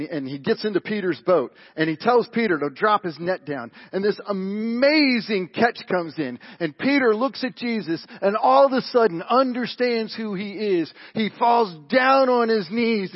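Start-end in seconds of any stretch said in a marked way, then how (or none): 3.9-13.1 raised, loud preacher's delivery; impassioned preaching. Acted. Preacher telling the congregation of Peter's awe, impassioned preaching. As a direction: preach it high and forceful, drawing out key words.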